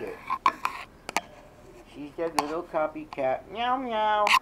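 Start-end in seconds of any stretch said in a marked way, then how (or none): 3.5-4.3 high meow meow; imitating a meow. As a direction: meow back at the cat. Calling to the cat.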